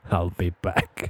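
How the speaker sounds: low, slow robot movie line; Terminator. Retold or imitated. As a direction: quote it like the movie cyborg. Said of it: "nicht anders als Terminator".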